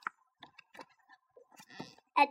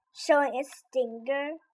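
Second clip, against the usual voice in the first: ordinary delivery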